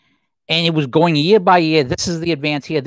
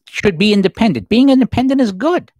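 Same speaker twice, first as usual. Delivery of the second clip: higher and louder, sarcastic agreement; mock endorsement; 6 semitones higher, 5 dB louder than usual. Host mocking the list's claim, sarcastic. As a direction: push it as if it were obvious.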